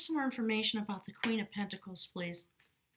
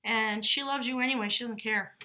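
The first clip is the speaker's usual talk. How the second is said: usual speaking voice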